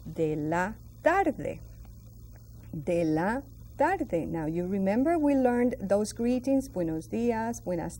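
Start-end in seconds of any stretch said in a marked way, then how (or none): 0.1-1.6 de la tarde drawn out slowly; teacher models the phrase slowly. Shown as a demonstration. Teacher modelling P M for learners, slow and clear. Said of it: "we're going to say". 2.7-4.2 de la tarde drawn out slowly; teacher models the phrase slowly. Shown as a demonstration. Teacher modelling P M for learners, slow and clear. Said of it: "we're going to say".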